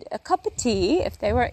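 badly faked British tea-time accent; attempted British accent. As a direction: have a go at a British accent and give up. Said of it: "I'm trying to do accents".

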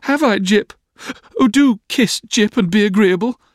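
put-on very high girlish appeal; Dora's wheedling. A narrator's reading. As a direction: pipe up in a high pleading voice. Dora appeals to her dog.

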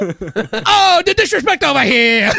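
hammed-up mobster outrage in an Italian-American accent; Tony Soprano. Imitating Art rehearsing the line in the bathroom, mobster accent. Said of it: "doing the Tony Soprano voice".

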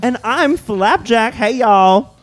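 high, slow, put-on host drawl; host persona voice. Co-host introducing himself in a high put-on voice. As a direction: sing out the intro as a character. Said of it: "I'm your host".

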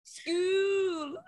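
high, soft mock wail; little girl saying school. Copying the viral girl's school, high wailing imitation. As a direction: draw it out like a whining child. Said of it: "When she says school".

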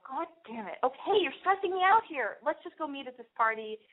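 mock stressed snap at her friend; exasperated. Stuck in traffic, exasperated with her girlfriend. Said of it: "And I go".

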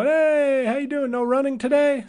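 playful imitation of the friendly man; cheerful neighborly greeting. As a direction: sing out a hello to a passing neighbor.

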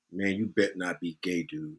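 low, soft warning voice; said in my ear. Retold or imitated. Bro murmuring a warning in his friend's ear. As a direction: lean in and mutter the warning low. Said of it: "or say in my ear".

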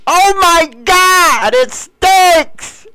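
high, slow, wailing disgust; disgusted teen cry. Retold or imitated. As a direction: wail it like a grossed-out teenager. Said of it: "all you heard was".

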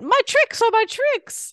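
high, loud, drawn-out mascot cry; cereal mascot voice. Acted. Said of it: "He said".

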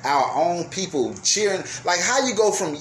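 ordinary talk, nothing marked

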